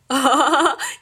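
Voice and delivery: a loud jeering cackle; mocking laugh. A narrator's reading. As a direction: laugh at him in triumph. Crow mocks fox.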